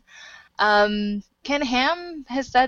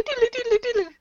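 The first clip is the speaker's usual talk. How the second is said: very high doodle jingle; squeaky-high imitated sound effect; homemade sound effect; much faster than usual. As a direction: reprise a silly mouth-made stinger. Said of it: "That's much better than my doodle".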